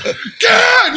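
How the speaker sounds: very high drawn-out yelp; mock terrified cry. Recruit as the metal door bangs open, mock terrified cry. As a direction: shout it in exaggerated panic, pitch way up. Recruit when all hell breaks loose.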